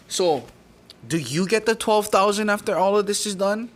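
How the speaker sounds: pointed accusation. Retold or imitated. Retold accusing question to the judge.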